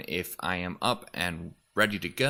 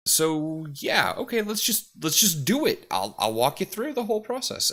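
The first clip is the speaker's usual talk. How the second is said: higher, eager and upbeat offer to help; imitating himself jumping into explaining to chat; eager helper; 8 semitones higher than usual. A touch of eager streamer voice. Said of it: "I will end up just going".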